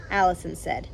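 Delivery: ordinary delivery, nothing marked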